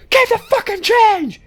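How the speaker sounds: high, slow yelled order; high mock yell. Robber yelling at the clerk to keep the change, slow high yell. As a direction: bark it at the cashier, hammed-up.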